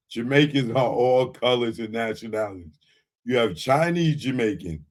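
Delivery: low, mocking put-on voice of the commenter. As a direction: read his comment back in a low mocking voice. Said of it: "Somebody told me I sound silly".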